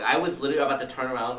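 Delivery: usual speaking voice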